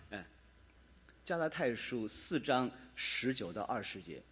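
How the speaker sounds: usual speaking voice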